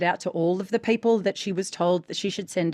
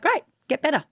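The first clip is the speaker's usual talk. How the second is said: lifted, brisk pep talk to a student; bright push to go get better; upbeat coaching; 6 semitones higher than usual. Retold or imitated. Coach urging an actor to train, brisk and lifted. Coach retells her blunt advice.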